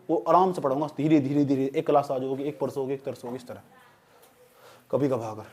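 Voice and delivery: low, softening steadily to a murmur; easy-going. Real, not acted. Promises unhurried classes, voice drops.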